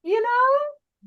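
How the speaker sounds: very high "You know"; high squeak. Real, not acted. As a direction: pitch it way up, mock exasperated. Exaggerated high follow-up.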